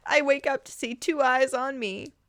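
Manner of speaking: ordinary delivery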